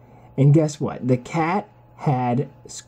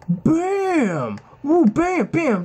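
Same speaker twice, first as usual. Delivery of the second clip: excited; 11 semitones higher, much slower than usual. Real, not acted. Narrating cat attacks excitedly.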